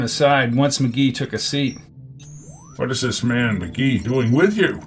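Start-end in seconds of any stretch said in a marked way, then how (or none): 2.7-4.8 lightly voiced leader, challenging; a hint of a stern commander; displeased first leader. A narrator's reading. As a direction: question a subordinate's judgement.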